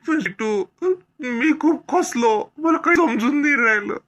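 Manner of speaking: heartbroken man close to tears. Acted. Brother pours out grief. (दुःखाने भरलेला, रडवेला आवाज)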